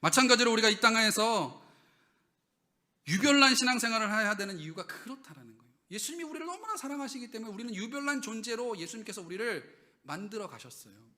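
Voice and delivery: loud, high preaching to the congregation; full-force preaching. Acted. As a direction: drive the point home from the pulpit.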